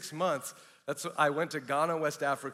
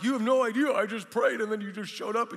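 higher, louder inner outburst; flabbergasted; 7 semitones higher, 4 dB louder than usual. Retold or imitated. Man in his mind to the stranger, astonished at answered prayer. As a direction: blurt it in amazed disbelief.

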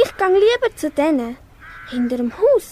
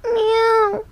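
high plaintive meow; a child's high kitten mew; 5 semitones higher than usual. Acted. Kitten Pitschi meows.

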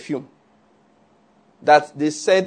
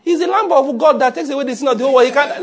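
laughing mock retort; 8 semitones higher, faster than usual.

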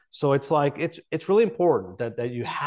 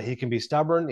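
usual speaking voice

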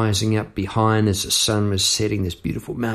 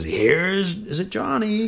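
Jack Nicholson; 11 semitones higher, 3 dB quieter than usual. Retold or imitated. Quoting the movie The Shining, mock creepy call. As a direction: sing out a creepy entrance line, softly. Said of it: "Jack Nicholson is".

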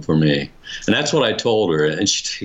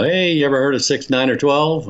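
put-on higher, quicker voice for canvassing girls; lightly acted higher-voiced approach; retold hail; 7 semitones higher than usual. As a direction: call out a pitch to a stranger, imitating. Retold girls hailing him.

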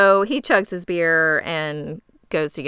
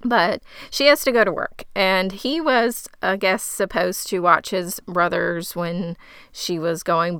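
ordinary delivery

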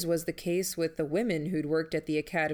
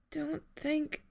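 high, soft and strained, breath failing; breathless; 10 semitones higher, 4 dB quieter than usual. Acted. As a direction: try to speak without enough air. Injured Jamie, no breath left.

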